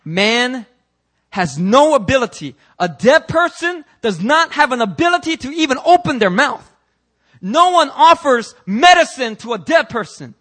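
loud, high preaching shout; preaching at full force. Real, not acted. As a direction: hammer it home to the congregation.

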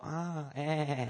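soft imitated bleating; sheep bleat. Sheep answering the shepherd's call, soft bleat. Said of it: "the sheep will go".

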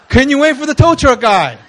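loud, high replay of a caller's request; exasperated mimicry. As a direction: belt it out as the punchline. Punchline repeat of the request.

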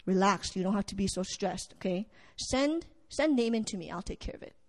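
soft, low and reassuring, fading almost to a whisper at the end; calming reassurance. Acted. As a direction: settle the panicking king down gently. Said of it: "and Elijah goes".

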